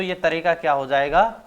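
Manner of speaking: ordinary talk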